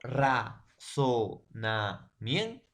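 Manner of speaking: razonamiento split slowly into its syllables, ra-zo-na-mien-to; teacher breaking razonamiento into syllables. Shown as a demonstration. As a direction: spell razonamiento out in slow separate syllables. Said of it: "vamos a tener la palabra".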